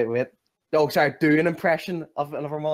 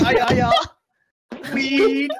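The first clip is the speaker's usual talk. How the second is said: slow, high, loud Dutch caricature; Dutch accent; 9 semitones higher, 6 dB louder, much slower than usual. Retold or imitated. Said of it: "Dutch accent".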